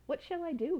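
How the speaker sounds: put-on wondering boy. A narrator's reading. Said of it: "he wondered".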